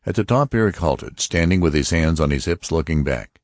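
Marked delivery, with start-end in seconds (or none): none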